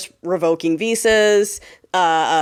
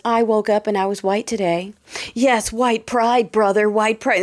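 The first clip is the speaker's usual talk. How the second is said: mock proud bro.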